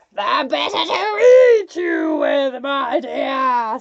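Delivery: loud, snarling wolf. A narrator's reading. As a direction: snarl it with menace. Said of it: "snarled the wolf".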